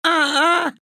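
loud, high shriek; loud cry. A narrator's reading. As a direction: shriek it out suddenly. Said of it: "громко закричала бабушка".

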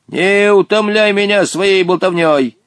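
high, imperious scolding from a genie; angry rebuke. A narrator's reading. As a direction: cut the chatter off crossly. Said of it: "сердито прикрикнул незнакомец". (сердитый окрик)

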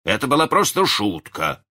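defensive cry. A narrator's reading. Husband excusing his trick to his wife, crying out. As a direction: exclaim it in self-defence. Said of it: "вскричал он".

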